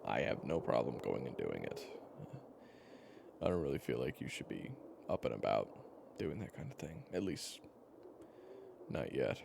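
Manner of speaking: usual speaking voice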